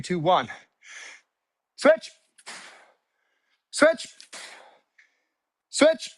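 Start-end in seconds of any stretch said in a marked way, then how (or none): 1.8-6.1 high, punchy coach cue; called-out cadence. Real, not acted. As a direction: call each switch to keep the class on beat. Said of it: "When I say switch, you switch".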